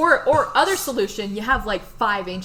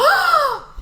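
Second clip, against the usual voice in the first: high, loud dramatic gasp; mock-shocked gasp; 6 dB louder than usual. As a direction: gasp as at a scandalous murder reveal. Mock shock at murder theory.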